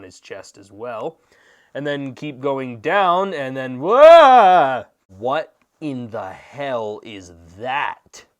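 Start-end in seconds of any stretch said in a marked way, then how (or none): 3.8-4.8 loud, drawn-out cry of alarm; mock-shocked holler; startled yell. 5.1-8.2 slow, stunned delivery; hammed-up slow-motion astonishment; mock disbelief.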